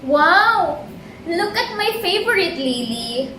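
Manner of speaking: high, bright-eyed boastful flower voice; proud lily. Acted. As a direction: show off your favorite like a proud flower. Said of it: "proud lilac lily says".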